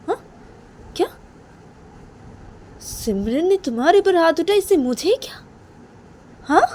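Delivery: incredulous father, pitch climbing very high; affronted father. Acted. (हैरान, नाराज़ पिता की चढ़ती आवाज़)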